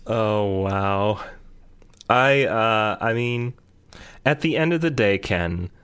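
usual speaking voice

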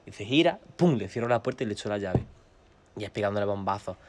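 ordinary delivery

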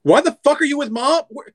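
high, fast, panicked questions; alarmed Oliver. Acted. Oliver panics at seeing his friend with Mom. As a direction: stammer then blurt out in alarm.

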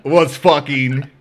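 real laughter breaking up the words; laughing. Real, not acted. Teller cracking up at his own story, laughing. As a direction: struggle through it slowly while cracking up.

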